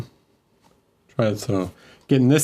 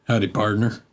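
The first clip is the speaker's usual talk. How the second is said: put-on Southern cowboy accent. Viewer asked for cowboy accent, light Southern greeting. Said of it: "it's not partner with the hard T".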